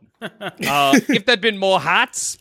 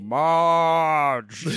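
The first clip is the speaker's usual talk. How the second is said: drawn-out dopey cartoon husband; Homer Simpson. Cartoon husband calling his wife, long lazy moan. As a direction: moan the name, fat-voiced. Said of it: "kinda like Homer Simpson".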